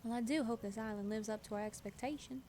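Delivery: put-on Elise, Southern accent. Villager hopes the island will suit her, put-on Southern accent. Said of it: "Princess and the Frog accent".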